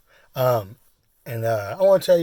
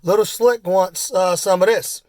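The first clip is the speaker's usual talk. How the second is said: higher, raised-voice call; retold yell; 7 semitones higher, 4 dB louder than usual. Biker asking dad across the table, retold yell. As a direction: imitate calling over a noisy table. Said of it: "He yells".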